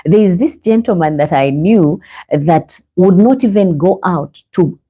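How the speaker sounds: normal talking voice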